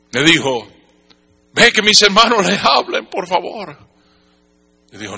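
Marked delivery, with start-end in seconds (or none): 1.5-3.8 high, urgent acted plea; pleading. Rich man asking that his brothers be told, pleading. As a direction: beg with a raised voice.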